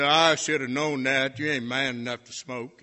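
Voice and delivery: loud scornful jeer; mocking taunt. Football star taunting the freshman before everyone, loud jeer. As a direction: sneer it out loud to the crowd.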